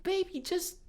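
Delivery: high-pitched pleading mom voice; put-on high mom voice; imitated mom. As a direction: coax him like a fretting mother. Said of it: "and the mom's like".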